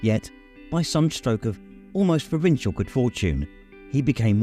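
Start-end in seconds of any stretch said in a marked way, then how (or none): none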